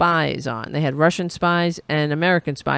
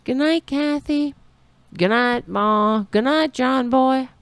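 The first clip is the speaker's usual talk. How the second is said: higher, lilting goodnight calls; sing-song Waltons goodnights; 7 semitones higher than usual. Retold or imitated. Host teasing a guest who left, with lilting Waltons goodnights.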